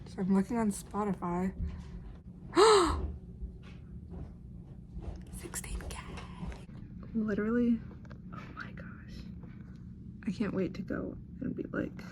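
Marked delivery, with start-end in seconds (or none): 1.6-3.5 gasp. Real, not acted. Sees she hit sixteen K. 5.4-9.2 stunned whisper. Real, not acted. Just saw sixteen K streams.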